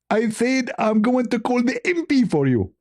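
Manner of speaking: high put-on voice of an angry caller; high scolding impression voice; mock Zakir Naik. S0 acts out Zakir Naik phone call.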